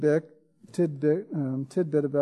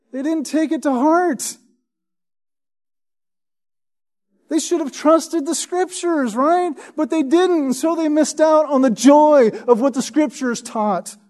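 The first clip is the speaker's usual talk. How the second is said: much higher, pressing reproach; emphatic preaching; 9 semitones higher, 4 dB louder than usual. Acted. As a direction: urge them, voice lifted high.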